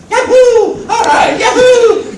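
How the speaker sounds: very high, loud, gleeful falsetto whoops; Mario. Acted. Voice actor imagines opening free merchandise, gleeful character whoops. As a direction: cheer like a tiny video game hero, loud and high. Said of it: "Are you the voice of Mario?".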